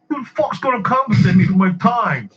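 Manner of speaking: high-pitched retold outburst, indignant; mock screaming customer. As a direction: imitate an irate customer blowing up. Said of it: "he starts screaming at her".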